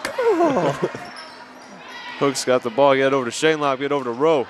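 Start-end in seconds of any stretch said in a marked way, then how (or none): 0.0-0.9 very high squeaky voice; squeaky high cheer. Pretend. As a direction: squeal it like a cartoon.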